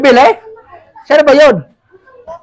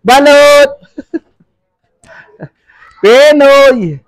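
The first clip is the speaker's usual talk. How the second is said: vendor's call; 3 semitones higher, much slower than usual. Real, not acted. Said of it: "sa pagsigaw ko".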